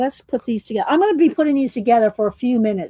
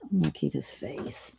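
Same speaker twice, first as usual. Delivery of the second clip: whisper; 4 semitones lower, 11 dB quieter than usual. Real, not acted. Aside while sorting stickers.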